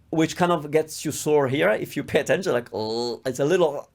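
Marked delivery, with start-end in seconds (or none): 2.7-3.2 soft, low "l" as if being choked; choked dark L. Shown as a demonstration. Teacher showing the soreness of English L, choked "l". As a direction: squeeze a held "l" in the throat. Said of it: "somebody's choking you".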